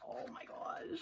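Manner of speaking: high, soft breath of amazement; awed whisper. Real, not acted. Gasping at a photo.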